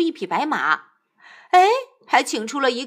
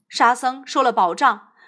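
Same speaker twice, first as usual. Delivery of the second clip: ordinary delivery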